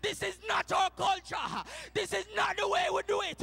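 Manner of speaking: loud, high, rousing preacher's shout; preaching at full force. Acted. Preacher rousing the church, loud. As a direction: drive it home to the congregation.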